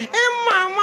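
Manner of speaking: very high-pitched comic call; playful high squeal of a call; mock calling out. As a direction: cry out far above your own voice. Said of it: "ಅನ್ಬೇಕದ".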